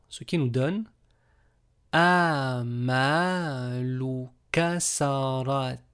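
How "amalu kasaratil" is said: Amalu kasaratil drawn out slowly; slow careful reading. Shown as a demonstration. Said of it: "slow final reading". (lecture lente et appliquée)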